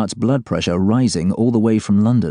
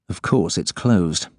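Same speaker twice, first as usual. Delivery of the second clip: usual speaking voice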